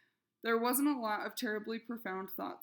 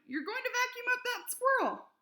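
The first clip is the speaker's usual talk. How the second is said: very high, loud alarmed yell; shouted warning; 6 dB louder than usual. A narrator's reading. Flora yelling at the neighbor through the window. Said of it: "she shouted".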